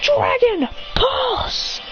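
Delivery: very high, long-drawn battle call; attack command. Acted. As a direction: draw out each word of the command, high. Trainer calls out an attack.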